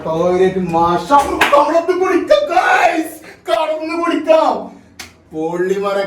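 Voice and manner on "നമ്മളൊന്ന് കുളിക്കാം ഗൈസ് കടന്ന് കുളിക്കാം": high, slow, drawn-out happy shout; excited shout. Real, not acted. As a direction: call it out with glee. Excited about the bathroom.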